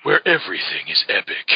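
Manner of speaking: a hint of an epic trailer narrator; lightly voiced trailer announcer; movie trailer voice. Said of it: "It would sound like the movie trailer guy".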